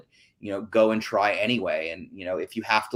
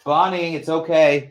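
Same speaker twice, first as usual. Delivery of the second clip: raised, higher call across the room; calling to the cat; 7 semitones higher, 7 dB louder, slower than usual. Real, not acted. Host calls to her screaming cat mid-stream, raised voice. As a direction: call out to soothe a yowling cat.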